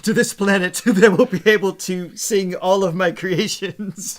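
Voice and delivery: mock prophecy. Host jokingly prophesies to co-host about an alien singer, mock grand. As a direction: announce it like an ancient prophecy, half laughing. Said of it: "The prophecy will be re".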